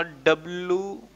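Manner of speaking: WDV said soft and fond like a pet name; said lovingly. Shown as a demonstration. Teacher names the second method affectionately, softly. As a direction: coo WDV gently. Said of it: "और इसको प्यार से बोलूंगा".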